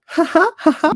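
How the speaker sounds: spelled-out high mock laugh; fake laugh. Mocking his own pun with a fake high ha ha. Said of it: "That was a funny little joke".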